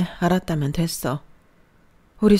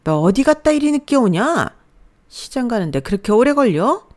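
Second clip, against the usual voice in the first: 높고 큰 소리로 다그치는 시어머니; 늦게 온 며느리를 몰아세우듯 큰 소리로; 화내는 시어머니; 5 semitones higher, 5 dB louder than usual. Acted. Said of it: "화를 내시더라고요". (며느리에게 화내며 큰 소리로 다그치듯)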